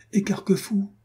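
high-pitched exclamation at normal volume. A narrator's reading. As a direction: cry out the name in recognition. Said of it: "s'écria monsieur de la Guerche".

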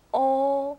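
"おー" held long with tense rounded lips. Shown as a demonstration. Teacher summing up the o sound, long tense "おー". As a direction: put force into a rounded "おー". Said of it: "力を入れるといいですよ".

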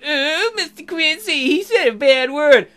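high, drawn-out nerdy tattletale; Joe Robinson. Acted. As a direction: whine it slowly like a kid telling on someone. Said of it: "That nerdy Joe Robinson does".

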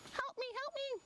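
tiny, very high cry for help; high-pitched frightened begging; pleading wombat. Acted. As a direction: squeak out a plea as the little wombat. Wombat calls for rescue.

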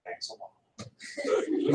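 slow, breathy sarcastic thanks; sarcastic whisper. Pretend. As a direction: whisper the thanks dryly, drawn out. Sarcastic aside about styrofoam cup.

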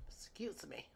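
ordinary talk

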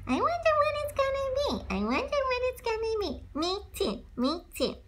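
very high, tiny squeak of a voice; high cartoon squeak, wondering; squeaky character voice. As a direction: play a small squeaky critter wondering aloud.

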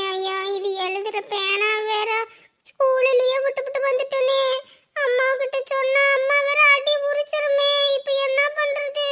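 drawn-out, soft worried whine; fretful whining. Acted. Child worried about mother's beating over a pen, whiny. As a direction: fret aloud, stretching the words.

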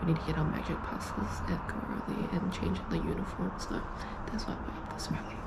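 quiet breathy morning whisper; whisper, keep it hushed. Real, not acted. Early morning, others asleep.

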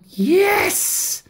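high, bright exclamation of yes; happy witch cry. A narrator's reading. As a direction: shout a cheerful yes. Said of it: "cried the witch".

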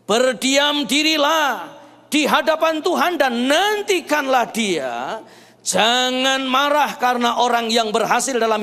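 loud, slow, emphatic psalm reading; forceful declamation with high, drawn-out openings; preaching scripture. Acted. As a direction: proclaim the verse, stretching key words.

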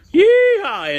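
high whooping cowboy yeehaw; yeehaw yell. Acted. Cowboy host whooping to his viewers. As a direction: let the yeehaw rip up high.

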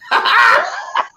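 a burst of real laughter, shrieked high; high shrieking laugh. Real, not acted. Guest laughing at her own joke, shrieking burst of laughter. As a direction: crack up with a high, loud shriek.

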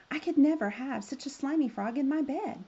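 high, loud disgusted outburst. A narrator's reading. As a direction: blurt it out in disgust. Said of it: "the princess burst out".

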